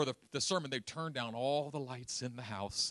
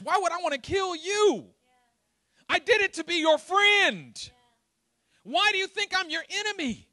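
building from pleading to high, loud cries; anguished Moses; 14 semitones higher, 10 dB louder than usual. Acted. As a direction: cry out hurt at being misread.

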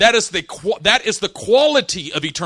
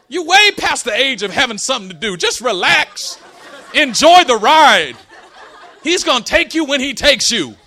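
raised, exasperated lecturing of an aunt; fed-up; 6 semitones higher, 6 dB louder than usual. Retold or imitated. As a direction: tell her off, pitch up, at the end of your patience. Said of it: "Finally, I got tired of it".

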